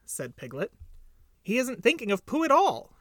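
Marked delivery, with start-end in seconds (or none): 1.4-3.0 high, loud little bird voice. A narrator's reading. Little bird corrects Piglet's guess, high emphatic voice. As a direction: contradict in a high, emphatic chirp.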